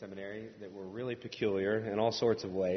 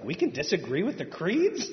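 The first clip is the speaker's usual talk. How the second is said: higher, louder, laughing in disbelief; astonished laughing question; 7 semitones higher, 6 dB louder than usual. Retold or imitated. To Steve, the preacher shocked by his claim, laughing disbelief. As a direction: ask it like a shocked young seminarian. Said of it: "I was like".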